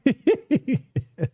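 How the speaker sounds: high giggle of nervous excitement; nervous laugh. Real, not acted. Trader laughing at his sweaty hands. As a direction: laugh it off, jittery. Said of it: "Oh, my hands are sweaty".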